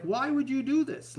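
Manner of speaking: disbelieving outburst at dog owners; exasperated. Retold or imitated. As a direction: throw up your hands at their choice. Exasperated at owners of working dogs.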